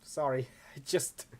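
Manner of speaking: laughing too much to finish; slow, broken off by laughter; acted breathless laughter. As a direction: try to talk while recovering from a laughing fit. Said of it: "begins coughing in an effort to catch her breath".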